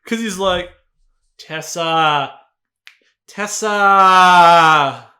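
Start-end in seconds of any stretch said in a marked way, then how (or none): 1.4-5.1 slow, louder, drawn-out lover's call; drawn-out mock call. Imitating Harden calling Tessa back, slow and drawn out. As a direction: stretch each word, lazy and louder.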